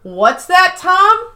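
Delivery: high, playful fake phone voice; mock phone call. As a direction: tease like a pretend call. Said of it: "And then Jim goes".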